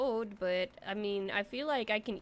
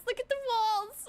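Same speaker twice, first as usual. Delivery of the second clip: higher and louder look at the walls; excited repeat; 13 semitones higher, 4 dB louder than usual. Real, not acted. Pointing out the walls to the viewers, excited and higher. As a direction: exclaim it in delighted disbelief.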